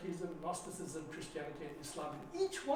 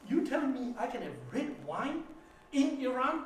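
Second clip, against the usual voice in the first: amazed disbelief; 6 semitones higher, 6 dB louder than usual. Retold or imitated. Amazed at wine in Iran.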